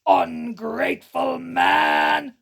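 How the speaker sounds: low, slow, loud monster growl; deep terrifying beast voice. Acted. Beast roaring at the thieving merchant, deep slow growl. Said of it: "a deep, terrifying voice growled".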